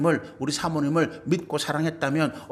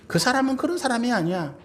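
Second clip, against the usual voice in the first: raised, higher insistent voice on 그런; firm insistence; 9 semitones higher than usual. Shown as a demonstration. Spouse defending partner against rumor, firm and raised. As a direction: declare it firmly, raising the voice.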